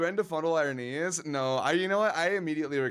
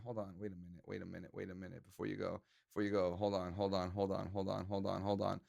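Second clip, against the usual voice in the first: much softer, lower, almost a whisper; hushed; 7 semitones lower, 10 dB quieter, faster than usual. Real, not acted. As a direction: call them back under your breath. Stopping a departing viewer.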